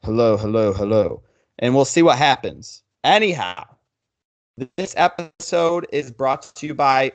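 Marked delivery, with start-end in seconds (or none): 0.0-1.2 put-on deep hello, pitched down. Shown as a demonstration. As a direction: drop your voice low for a mic check. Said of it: "talk with a really goddamn deep voice".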